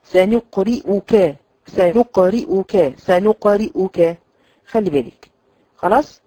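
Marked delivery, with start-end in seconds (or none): 0.0-4.2 سنقرئك slow and louder, kasra under the hamza clear; careful kasra. Shown as a demonstration. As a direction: stretch سنقرئك keeping the kasra. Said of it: "هنراعي الكسر في الكلمتين".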